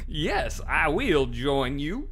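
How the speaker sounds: loud, high, slow cartoon voice; Goofy voice. Voicing a game character as Goofy. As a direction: drawl it like Goofy. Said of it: "Turning into Goofy".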